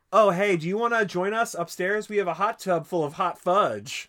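loud, high, showy invitation; loud public tease. Retold or imitated. She shames him outside his building with a loud, showy invite. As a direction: broadcast it so the whole street hears. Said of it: "She starts putting him on blast outside".

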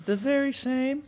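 sidle up and sell yourself. Acted. Sonic's girlfriend continues.

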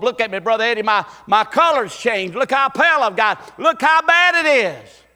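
high, loud pleading as a sick man; voicing a sick man. Sick man shows the pastor his pallor, loud plea. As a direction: show your pale skin, beg. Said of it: "sick people pleading".

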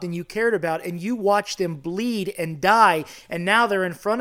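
fervent, emphatic preacher's appeal; impassioned preaching. Real, not acted. Preaches with rising fervour.

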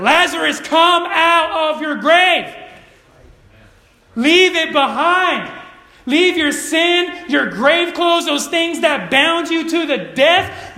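high, slow, booming summons; commanding shout. Acted. Preacher as Jesus ordering Lazarus out of the grave, commanding shout. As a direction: shout each phrase as an order. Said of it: "He said".

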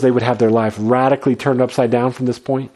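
normal talking voice

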